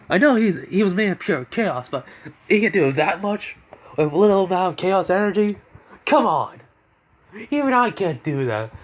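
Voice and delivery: high-pitched acted disbelief; exasperated, astonished rant; incredulous outburst. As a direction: protest in stunned, high disbelief. Shadow, stunned at the other's power.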